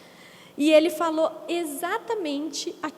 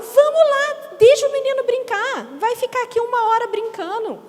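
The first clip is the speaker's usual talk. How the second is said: high, loud, cheery insistence; won't take no for an answer; pushy churchwoman; 7 semitones higher, 6 dB louder than usual. Retold or imitated. Churchwoman urging the mother inside, loud and cheery. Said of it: "ela falou".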